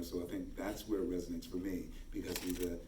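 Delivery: ordinary talk, nothing marked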